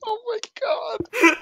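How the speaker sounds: high, slow, wailing laugh; laughing cry. Real, not acted. Reacting to an offensive joke.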